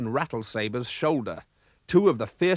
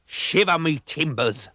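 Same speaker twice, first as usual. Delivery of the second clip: slow, gruff pirate exclamation; pirate roar; 4 semitones higher, slower than usual. A narrator's reading. Pirate captain reacts to the sighting, slow roar. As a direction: roar it like an old sea dog.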